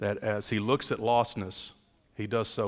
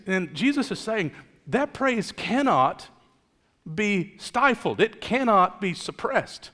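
emphatic preaching; 7 semitones higher, 4 dB louder than usual. Real, not acted. Preacher declares praise cannot be suppressed, emphatic preaching. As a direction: stretch out each phrase with conviction.

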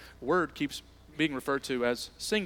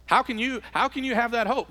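impassioned question; 7 semitones higher, 4 dB louder than usual. Acted. Rhetorical question at full force.

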